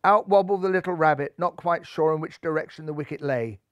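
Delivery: plain reading voice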